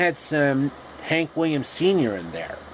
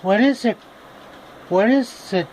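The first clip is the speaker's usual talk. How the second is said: put-on high girl voice; 8 semitones higher than usual.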